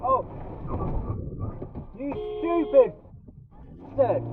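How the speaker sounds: high-pitched furious swearing; swearing tirade. Real, not acted. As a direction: rant high and strained at the driver. Driver cut off, high-pitched swearing.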